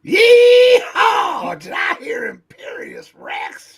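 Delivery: high whooping yell; loud whoop. Pretend. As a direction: burst in with a big yee-haw. Bursting in with a whoop.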